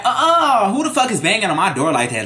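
high, loud, affronted outburst; retold indignant challenge. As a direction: challenge whoever is banging on the door. Retold reaction to banging.

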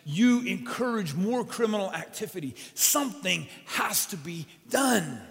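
loud, high, slow angry rant; furious shopkeeper demanding punishment, loud and venomous. Acted. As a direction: spit it out with venom at the mayor. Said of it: "after he speaks with all his venom".

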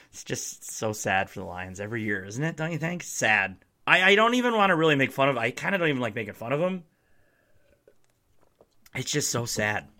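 sarcastic hushed sympathy; mock pity. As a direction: pretend to grieve for them.

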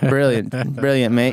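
lightly British brilliant, mate; a cheery mock British mate. Trading British lines, a light mock British. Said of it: "Cockney accent game".

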